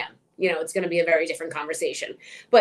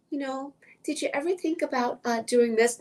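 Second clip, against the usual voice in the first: mock-gentle, hesitant question; tentative advice; 5 semitones higher than usual. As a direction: imitate someone gingerly broaching a subject. Imitates tentative, tiptoeing advice giver.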